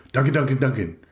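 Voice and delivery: bursting in with news; excited character voice, names tumbling out. A narrator's reading. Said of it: "He's so excited".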